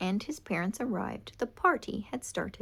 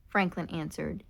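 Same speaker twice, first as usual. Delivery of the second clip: plain reading voice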